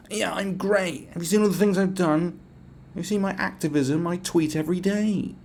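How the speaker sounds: lampoon a boastful online activist; lightly voiced smug bragger; mocking smug activist.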